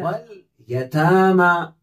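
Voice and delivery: stretched, careful read of वल यतामा; slow Quranic reading. Shown as a demonstration. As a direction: recite वल यतामा slowly, holding the long ता and मा. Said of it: "वाव लाम जबर वल".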